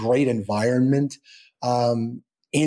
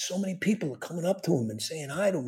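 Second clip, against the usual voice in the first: soft, slightly raised, quick murmur; hushed awe; 5 semitones higher, 8 dB quieter, faster than usual. Retold or imitated. Dad marveling to mom about his son, hushed. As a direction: imitate marveling under the breath.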